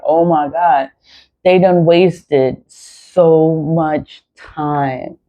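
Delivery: ordinary talk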